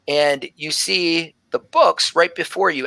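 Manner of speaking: ordinary talk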